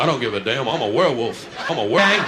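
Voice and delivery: a hint of a tough guy voice; mock soldier. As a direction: growl it with bravado. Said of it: "Some color guy".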